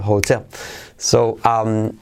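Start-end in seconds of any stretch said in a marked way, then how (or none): 0.0-0.4 "hotel" as ho-TEL, like the Spanish word; stress on second syllable. Shown as a demonstration. Teacher confirming the correct "hotel", stressed ho-TEL. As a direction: put the weight on TEL in "hotel". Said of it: "Hotel, como en español".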